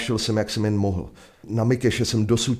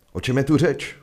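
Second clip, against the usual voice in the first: sheriff calling out; 7 semitones higher, 4 dB louder than usual. Acted. Sheriff Jeff calls to crowd.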